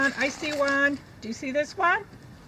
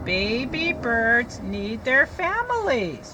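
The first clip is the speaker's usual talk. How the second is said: slow, careful read-aloud; teacher reading the page aloud; slower than usual. Shown as a demonstration. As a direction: read it slowly for the class. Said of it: "It says".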